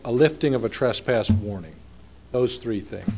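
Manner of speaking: usual speaking voice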